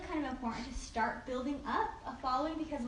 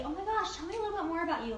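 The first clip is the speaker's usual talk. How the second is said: higher, quick enthusiastic reply; acted gushing; 5 semitones higher, faster than usual. Stylist replying to a new online contact, acted gushing. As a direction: gush eagerly to a new acquaintance.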